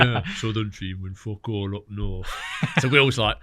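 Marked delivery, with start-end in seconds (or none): 0.0-2.3 drawn-out impression, slow and put-on; slow caricature of a man's declaration; Alan Scott. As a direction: repeat the impersonation, stretching each word. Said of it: "best Alan Scott impression ever".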